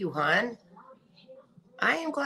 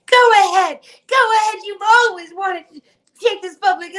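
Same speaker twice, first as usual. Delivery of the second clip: high, loud mocking voice as her rival; 13 semitones higher, 8 dB louder than usual. Vlogger mocking her rival's taunt in a high loud voice. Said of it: "she wanted me to go public".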